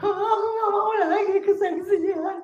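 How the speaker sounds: high, loud and slow, imitating a frightened plea; retold trembling plea. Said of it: "Gemeteran saya".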